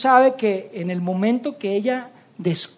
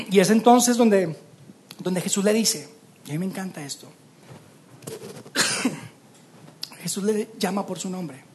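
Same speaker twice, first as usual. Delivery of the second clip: ordinary delivery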